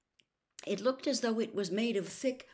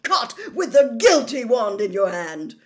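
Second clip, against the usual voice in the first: very loud, raised, triumphant fury; roared accusation; 5 semitones higher, 12 dB louder than usual. A narrator's reading. Official condemns the elf over the wand, roaring. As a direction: bellow it at the culprit. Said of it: "Mister Diggory roared".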